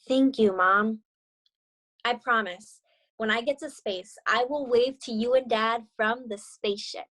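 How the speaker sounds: gentle read-aloud storytelling voice; story narration. A narrator's reading. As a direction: read it like a picture book to young students. Teacher reads picture book aloud.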